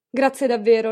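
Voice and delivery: loud, dry, cutting thanks; mock gratitude, cold and curt; sarcastic thanks. Aunt brushing off the maid's hope, sarcastic.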